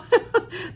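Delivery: a quick, high delighted giggle; short burst of real laughter. Real, not acted. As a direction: giggle at the little pirates. Laughing at the pirates.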